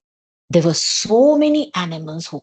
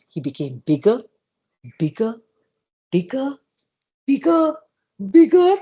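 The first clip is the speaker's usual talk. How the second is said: growing bigger; 2 semitones higher than usual. A narrator's reading. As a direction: swell the voice with each bigger.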